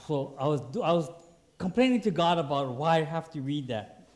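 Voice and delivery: normal talking voice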